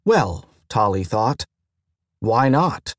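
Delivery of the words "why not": plain reading voice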